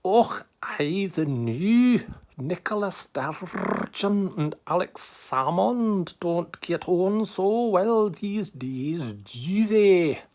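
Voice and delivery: lightly put-on Scottish accent, slow; a hint of a Scottish brogue, drawn out; comic Scottish accent on request. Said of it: "do a Scottish accent".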